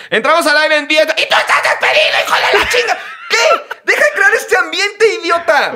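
high, loud mock yell of a furious boss; a boss shrieking at the crew, hammed up high and loud; retold yelling. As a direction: scream it like a tyrant producer. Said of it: "se pone a gritarnos".

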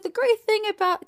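lightly mocked doctor; doctor quote. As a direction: pitch it up as the cheerful doctor. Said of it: "She's like".

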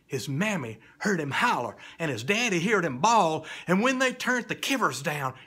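swelling loud storytelling. A narrator's reading. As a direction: rise in volume with the panic. Parents hear boy holler.

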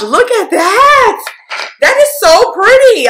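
high-pitched, drawn-out delight; delighted gushing. Real, not acted. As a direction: squeal with admiration over a pretty color. Gushing over a pretty shade.

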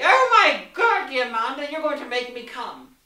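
mock orgasmic exclamation, mostly on the first words; mock ecstatic cry. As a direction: overplay a breathless climax, teasing. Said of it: "Or you could say".

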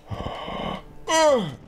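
sharp high gasp of alarm; startled gasp. Real, not acted. Climbing gamer nearly slipping, startled high gasp. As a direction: suck in air at a near fall. Near fall while climbing.

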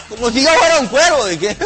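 ordinary talk

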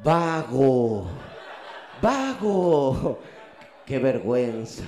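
loud mock scolding, lazy!; scolding conscience. Inner capitalist conscience scolding the sleeper, loud mock scold. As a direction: bark the insult at yourself. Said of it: "una especie de conciencia capitalista".

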